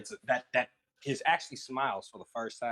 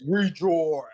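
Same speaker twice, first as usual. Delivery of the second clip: loud demonic shout; 7 semitones higher, 9 dB louder than usual. Acted. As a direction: bellow it like a possessed demon. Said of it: "Kind of demonic voice".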